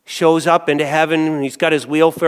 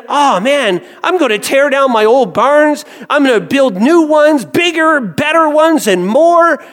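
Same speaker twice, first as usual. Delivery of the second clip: higher, louder, greedy excitement; eager rich man; 9 semitones higher, 5 dB louder than usual. Acted. Rich businessman plans his new barns, loud and greedy. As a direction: gloat over the bigger barns.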